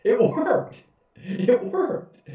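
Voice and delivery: high, gleeful; delighted. Real, not acted. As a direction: cheer that the trick paid off. Delighted the trick worked.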